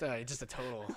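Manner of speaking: normal talking voice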